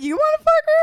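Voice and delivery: shocked squeal. Acted. Shocked mock quote.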